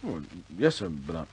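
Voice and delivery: ordinary talk, nothing marked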